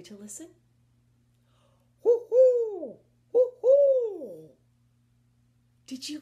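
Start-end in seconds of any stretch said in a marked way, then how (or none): 2.0-4.5 high, loud hooting owl call; loud owl hoo-hoo. Shown as a demonstration. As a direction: hoot like an owl in the tree. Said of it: "makes a sound like this".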